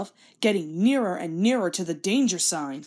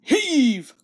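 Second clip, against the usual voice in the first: higher, louder command; calling heave; 6 semitones higher, 6 dB louder than usual. Acted. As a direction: call the pull to the team. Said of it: "called the Fat Controller".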